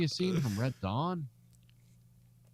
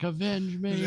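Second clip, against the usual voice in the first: overacted death scene; 9 semitones higher, slower than usual. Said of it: "He'd be like".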